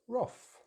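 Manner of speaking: wrath as roth, pitched high; correct British pronunciation. Shown as a demonstration. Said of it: "the correct British English pronunciation".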